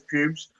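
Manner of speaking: "pubes" in a Northern Ireland accent; Northern Irish vowel turning the name into "pubes"; accented slip. Real, not acted. Co-host names the injured captain, accidental accented "pubes". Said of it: "He broke his pubes".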